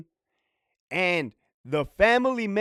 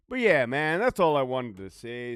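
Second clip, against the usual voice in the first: ordinary delivery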